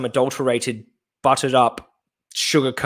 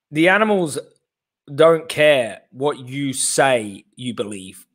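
normal talking voice

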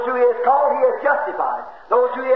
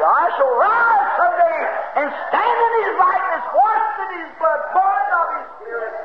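higher and ringing, shouted out; preaching shout; 6 semitones higher than usual. Real, not acted. Preacher proclaiming his own resurrection to the congregation, shouting. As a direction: cry it out in triumph.

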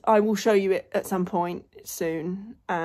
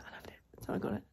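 guilty whisper; 14 dB quieter than usual. Real, not acted. To viewers about the bag she shouldn't buy, whispered. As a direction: drop to a hushed whisper, confessing.

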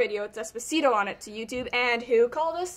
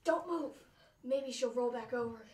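hushed; 8 dB quieter than usual. Acted. Trying not to wake girl.

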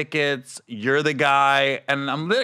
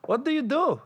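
Filipino nurse voice; 12 semitones higher, 3 dB quieter than usual. Retold or imitated. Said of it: "So she goes".

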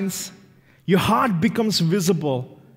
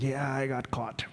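much softer, caught-out confession; sheepish admission; 5 semitones lower, 11 dB quieter than usual. Acted. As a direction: admit it meekly, under your breath. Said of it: "I was like".

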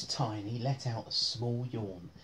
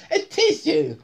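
loud, high comic plea; sneezy character's high call; high sneezy squeak; 9 dB louder than usual. Acted. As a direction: squeal for a tissue.

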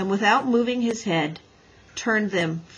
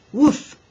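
short, loud imitated bark; single voiced dog bark; dog's woof; 5 semitones higher, 9 dB louder than usual. A narrator's reading. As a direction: give one high woof like a dog. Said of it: "and said".

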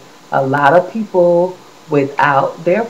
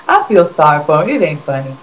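normal talking voice

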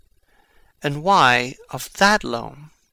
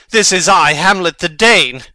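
much louder, higher proclamation of his name; defiant declaration; 4 semitones higher, 9 dB louder than usual. Acted. Hamlet confronting the mourners at the grave, loud and defiant. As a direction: announce yourself as a challenge.

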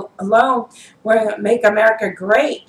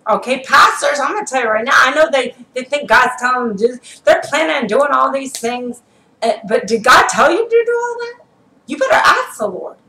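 higher, raised-voice warning to pastors; warning sermon; 3 semitones higher than usual. Acted. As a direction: call the pastors out, voice cracking at the end. Preacher warns pastors.

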